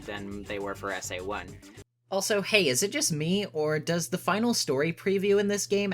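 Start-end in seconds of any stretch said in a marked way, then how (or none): none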